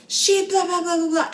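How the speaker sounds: high, loud mocking babble; mock ranting. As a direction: parody the owner flipping out. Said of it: "he's flipping out".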